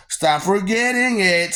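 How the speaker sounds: ordinary talk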